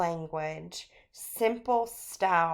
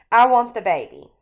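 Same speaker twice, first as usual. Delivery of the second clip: louder, fast shouted demand; retold scream; 3 semitones higher, 11 dB louder, faster than usual. Husband screaming at wife for the baby. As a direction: yell it at her, mock furious.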